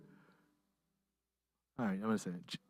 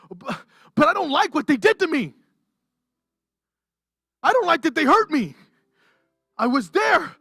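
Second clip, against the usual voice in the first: higher, louder, pleading with God; hurt believer pleading; 9 semitones higher, 4 dB louder than usual. Acted. A hurt believer begging God for justice, pleading. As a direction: voice a wounded person begging God to act. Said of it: "is like".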